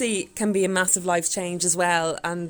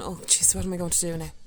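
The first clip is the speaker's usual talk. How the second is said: soft, subdued mock self-pity; lightly acted gloomy murmur; mock moping; 3 semitones lower, 6 dB quieter than usual. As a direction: mope it quietly, as if feeling sorry for yourself. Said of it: "you could be moping in the corner going".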